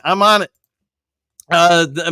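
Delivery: quick mock French accent. Shown as a demonstration. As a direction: toss off the line in a put-on French accent. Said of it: "to put a French accent in there".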